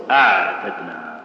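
"أعتدنا" said slowly with the ع half held, half flowing; ain held midway. Shown as a demonstration. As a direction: give the ع in "أعتدنا" a balanced middle sound. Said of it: "تخليه متوسط تقول".

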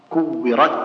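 كورت ending on a shut T, no puff; sound stopped only. Shown as a demonstration. Teacher showing a common error, final sound stopped only. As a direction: block the final T of كورت and hold the breath. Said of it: "يحبس الصوت فقط".

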